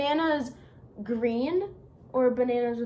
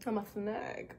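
put-on Southern accent; 3 semitones lower, 8 dB quieter than usual. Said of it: "talk in your Southern accent".

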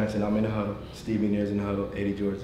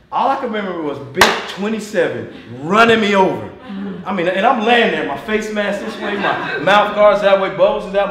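higher and louder, laughing through the words; laughing storytelling; 8 semitones higher, 10 dB louder than usual. Real, not acted. Coach laughing about being run over. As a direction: laugh at your own disaster. Laughing while telling the tackle.

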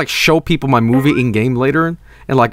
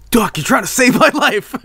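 higher-pitched mock cartoon hero; Luigi; 7 semitones higher than usual. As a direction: act it out in a raised character voice. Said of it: "Luigi being like".

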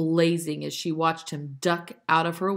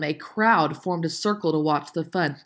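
ordinary delivery

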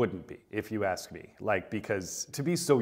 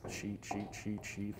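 barely voiced, much softer; muttering to himself while watching; hushed murmur; 12 dB quieter, slower than usual. Real, not acted. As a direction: murmur it under your breath. Hushed reading of the screen.

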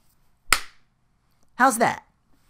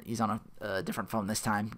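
ordinary delivery

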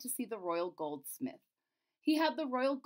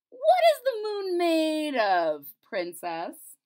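jester voice; 6 semitones higher, 10 dB louder, slower than usual. Acted. Jester asks slowly.

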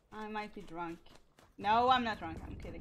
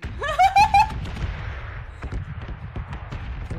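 loud, high burst of laughter; real high cackling laugh; long laughing fit; 14 dB louder than usual. Real, not acted. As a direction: laugh hard until it trails off. Long laughing fit at teammate's teasing.

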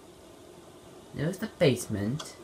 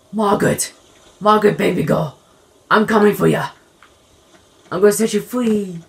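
loud, high calls across the house; calling out loud; 4 semitones higher, 11 dB louder, faster than usual. Acted. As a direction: shout to her as if rescuing her. Calling out to trapped Margaret.

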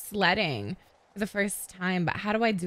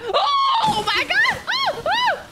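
very high, loud, slow shriek; excited shriek; 4 dB louder, much slower than usual. Real, not acted. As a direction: shriek in thrilled panic. Watching first person ski.

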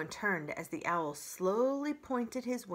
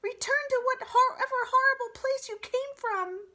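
high squeaky bird voice, loud; a tiny bird shooing a monster away; squeaky sparrow; 8 dB louder than usual. A narrator's reading. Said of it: "squeaked Dart".

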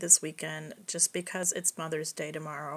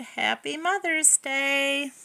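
put-on excited kids; 6 semitones higher, slower than usual. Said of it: "we cried".

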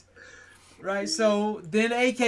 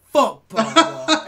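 louder, drawn out, with a laugh; slow, loud punchline; 4 semitones higher, 8 dB louder, much slower than usual. Retold or imitated. Religious host finally cursing in his own promo, loud and slow. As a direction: land it slowly, a laugh breaking in. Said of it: "I say".

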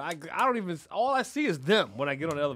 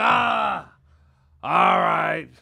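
loud, drawn-out groaning consent; mock exasperated; 2 semitones higher, 13 dB louder, much slower than usual. Friend relents to begging for party entry, mock exasperated. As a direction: imitate giving in noisily.

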